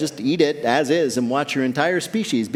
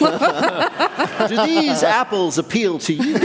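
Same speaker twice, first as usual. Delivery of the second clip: slow, higher, prim and overly correct; mock proper speech; 8 semitones higher, much slower than usual. Host giving the accentless version of the Boston line, prim. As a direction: say it like a stiff executive, slowly. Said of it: "proper version of the Boston line".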